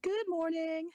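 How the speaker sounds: high, loud greeting shouted across; loud, excited morning hello; cheery call. Acted. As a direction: call it out to friends far off.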